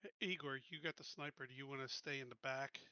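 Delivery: ordinary talk, nothing marked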